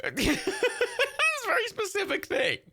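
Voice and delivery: high, slow words through real laughter; laughing at the oddity. Real, not acted. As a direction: giggle it out, amused. Laughing at odd Spielberg fact.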